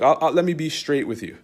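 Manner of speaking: normal talking voice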